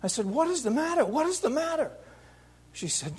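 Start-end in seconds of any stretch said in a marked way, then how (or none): 0.3-1.9 high, pleading question; frantic plea. Retold or imitated. Son begging his mother for news, frantic and high. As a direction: repeat it higher each time, desperate.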